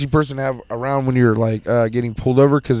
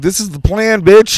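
emphatic retort; 7 semitones higher, slower than usual. Retold or imitated. Retold retort to panicking cousin.

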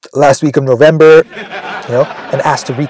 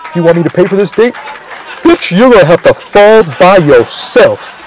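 angry brush-off; 2 semitones higher than usual. Retold or imitated.

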